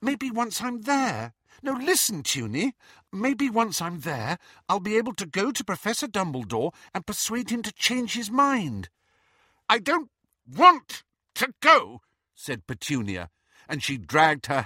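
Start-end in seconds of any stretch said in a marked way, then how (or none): 0.0-8.9 high-pitched, earnest pleading. A narrator's reading. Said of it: "seemed to be pleading with her sister". 9.7-12.0 high, loud, slow and emphatic; angry refusal. A narrator's reading. Acted quarrel between two sisters.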